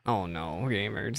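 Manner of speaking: usual speaking voice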